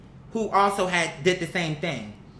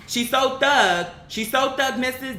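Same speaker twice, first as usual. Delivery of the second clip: slow, higher sneering mimicry of a tough girl; mocking thug act; 6 semitones higher, slower than usual. Vlogger mocking a reality star who poses as thug, sneering mimicry. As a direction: drag it out like you're making fun of her toughness.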